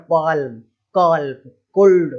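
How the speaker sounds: loud "पाल्म, कॉल्प, कुल्ड" with every L sounded; mock misreadings. Teacher scolding wrong readings, "पाल्म" with L sounded. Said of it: "इस तरीके से नहीं बोलना है".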